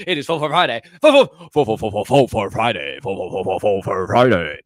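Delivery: hammed-up stumble shouting Faux Fur Friday; loud stumbling tongue twister. Shown as a demonstration. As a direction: trip over the faux on purpose, loudly. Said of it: "Say that ten times fast".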